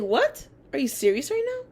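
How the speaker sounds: high, loud stunned outburst; retold loud shocked what; shocked disbelief. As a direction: blurt it out in sheer disbelief. Shocked at teacher's denial.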